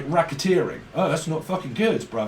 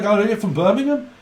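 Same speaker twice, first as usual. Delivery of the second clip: higher rising Brummie question; Brummie accent; 5 semitones higher, 4 dB louder than usual. Retold or imitated. Brummie meets another Brummie, rising mock Brummie accent. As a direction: ask it gladly in a mock Birmingham accent. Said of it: "That's how they speak up in Brummie land".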